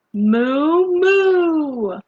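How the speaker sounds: high, loud cow moo. Acted. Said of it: "He can sound like a cow".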